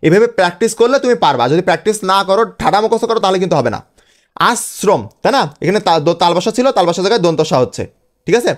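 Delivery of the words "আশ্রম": আশ্রম split "as... srom"; broken into pieces. Shown as a demonstration. Teacher models breaking words up, আশ্রম in two pieces. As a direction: say আশ্রম as two separate syllables. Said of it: "ভেঙে ভেঙে উচ্চারণ করবা".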